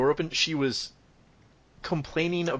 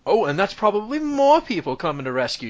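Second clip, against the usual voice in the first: raised, higher mock shout of an angry mother; shouting woman; 8 semitones higher, 4 dB louder than usual. Mother hearing a knock at the door, shouting. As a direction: call out like a fed-up mother. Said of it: "the woman shout".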